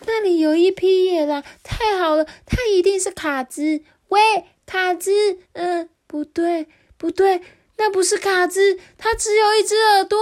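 high, loud little goat crying out; panicked goat yelling; shouting. Acted. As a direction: shout in alarm, pitched high. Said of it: "咩妹也在大叫说".

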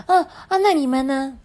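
high-pitched, eager question; full of anticipation. Acted. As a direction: ask eagerly as a little storybook classmate. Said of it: "充满期待地说".

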